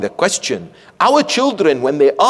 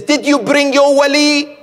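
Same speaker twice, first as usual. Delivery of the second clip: higher, louder stern question; pointed, raised demand about the guardian; demanding head teacher; 9 semitones higher, 4 dB louder than usual. Retold or imitated. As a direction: press the boy for an answer.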